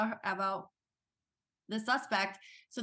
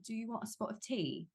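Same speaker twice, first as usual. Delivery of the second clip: soft put-on British accent; 6 dB quieter than usual. Shown as a demonstration. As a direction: slip into a British accent, softly. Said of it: "jump into that accent".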